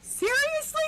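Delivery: very high, squeaky "Seriously?"; relieved shock at the good news; stunned relief. Retold or imitated. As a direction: squeal it in disbelief. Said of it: "And I'm like".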